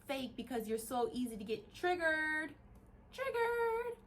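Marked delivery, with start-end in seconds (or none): 1.7-2.5 drawn-out word. Real, not acted. First take, word drawn out. 3.1-4.0 TRIGGERED stretched out very high; high, drawn-out teasing TRIGGERED; mocking repeat. Real, not acted. Mocks being triggered, sing-song repeat.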